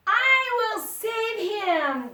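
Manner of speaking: high, loud, slow heroic call. Acted. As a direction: shout the promise to save him. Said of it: "called out".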